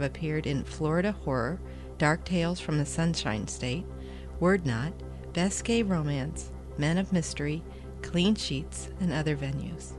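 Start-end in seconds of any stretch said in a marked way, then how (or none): none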